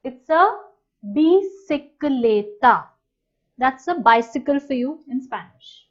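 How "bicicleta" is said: bicicleta drawn out for the class; teacher leading a choral repeat; slow repeat-after-me. Shown as a demonstration. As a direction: lead them to repeat bicicleta slowly. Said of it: "Repeat that with me".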